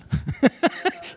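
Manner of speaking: breathy high-pitched chuckle; high laugh. Real, not acted. Preacher laughing at the houseguest's appetite. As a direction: laugh at the memory. Funny story about eating guest.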